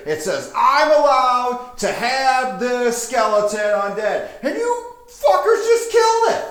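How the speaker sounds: builds from plain to very high and loud; indignant necromancer. Acted. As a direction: wind up into an outraged shriek. Necromancer protests with paperwork.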